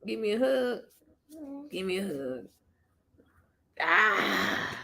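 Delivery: soft hug request; soft tender. Real, not acted. Hugging child softly.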